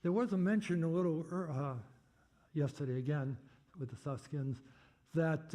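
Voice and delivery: ordinary talk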